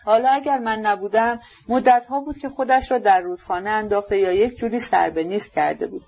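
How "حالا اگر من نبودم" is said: usual speaking voice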